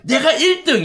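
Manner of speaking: high-pitched cry of acted rivalry; acted shout. Preacher mimicking someone shouting to be first, high acted shout. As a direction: call it out high, like claiming victory. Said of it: "이렇게 외치는 건".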